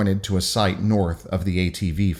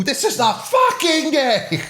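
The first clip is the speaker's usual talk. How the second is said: high, drawn-out joyful scream; slower than usual. Shown as a demonstration. Reader shows co-host how much they like it, screaming. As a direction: scream it with relish, stretching the end. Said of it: "do you just like screaming".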